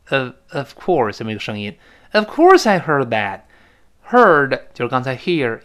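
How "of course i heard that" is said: "Of course" slow and emphatic; stress on OF COURSE. Shown as a demonstration. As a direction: stretch "course", falling to the end. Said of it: "这of course在这儿要重读了". (“Of course”慢而重地读)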